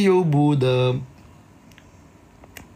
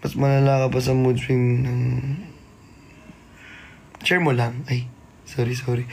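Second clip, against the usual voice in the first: ordinary delivery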